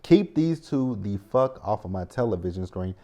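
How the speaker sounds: ordinary delivery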